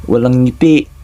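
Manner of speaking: ordinary delivery, nothing marked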